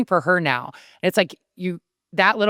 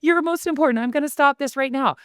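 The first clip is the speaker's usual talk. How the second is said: put-on higher, sugary apology to a child; mock doting mom; 6 semitones higher than usual. Mom apologizing to son mid-interview, sugary high. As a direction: sweetly coo to the kid in a high voice.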